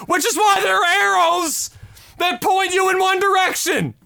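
rising into a furious yell; building up to yelling at the bad driver; ranting shout. Real, not acted. Telling his cohost about the near crash, building to a shout.

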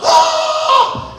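high, loud battle yell; army's wordless roar; war cry. Acted. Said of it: "the war cry is on".

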